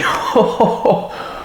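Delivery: airy high laugh, no voice; breathless laugh. Real, not acted. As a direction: burst into a silent, breathy laugh. Laughing after tasting drink. (rire soufflé et aigu)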